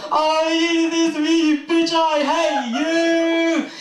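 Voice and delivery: very high, slow, loud hateful wail; furious woman in labour. Acted. Mother in labour screaming at her husband, high and drawn-out. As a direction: drag out every word as if in agony.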